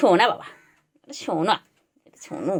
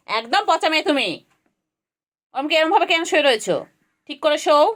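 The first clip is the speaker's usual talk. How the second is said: higher, playful scolding of a naughty pet; mock scolding; 6 semitones higher than usual. Scolding her cat for lying badly, playful mock scold. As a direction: pretend to tell off the cat, pitched up.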